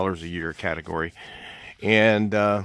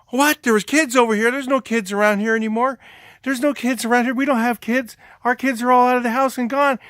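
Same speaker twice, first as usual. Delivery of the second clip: high, loud imitation of a baffled lady; hammed-up incredulous homeowner; mock bewilderment; 7 dB louder than usual. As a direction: protest in exaggerated disbelief. Lady baffled by kid story.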